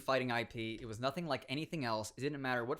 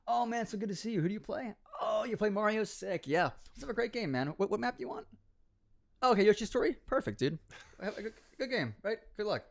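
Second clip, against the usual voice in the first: higher, chipper mock friendliness; friendly gamer; 8 semitones higher than usual. Imagined friendly opponent greeting you before a match, chipper and higher. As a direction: play the overly nice opponent. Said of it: "They imagine it being like".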